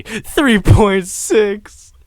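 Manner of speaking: loud, high, drawn-out outburst; stunned, stretched-out shout; shocked yell. Real, not acted. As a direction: yell it out slowly in disbelief. Shocked reaction to the stat.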